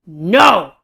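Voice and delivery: loud, emphatic refusal; one forceful shouted word. A narrator's reading. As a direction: burst out to stop him leaving. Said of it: "I shout".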